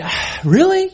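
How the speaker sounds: high-pitched, exasperated protest; mock reluctance. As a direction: whine it upward in reluctant disbelief. Acts own reluctance toward God.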